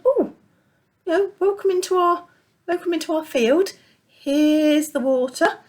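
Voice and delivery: a friendly sheep offering its field to newcomers; welcoming sheep. Acted. Sheep voiced in the story.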